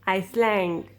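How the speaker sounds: ICELAND pronounced 'ice-land', S sounded; wrong pronunciation. Shown as a demonstration. Teacher showing students what never to say, S-sounded wrong pronunciation. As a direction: say Iceland with an audible S before the L. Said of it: "You don't ever say".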